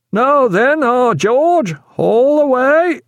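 high, loud shipboard hail, drawn out at the end; calling out. A narrator's reading. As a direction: call the order up to the crew. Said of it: "until Mister Granger called".

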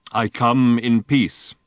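a giant robot greeting, slow and heavy. Acted. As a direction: intone it like a huge machine.

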